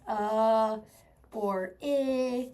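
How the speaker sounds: UH drawn out, then I as a drawn-out short "ih"; schwa sounds. Shown as a demonstration. Teacher repeating the two schwa sounds, each held. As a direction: hold UH, pause, then hold "ih". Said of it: "it makes these two sounds".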